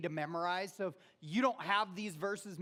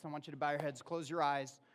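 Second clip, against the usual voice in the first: ordinary talk, nothing marked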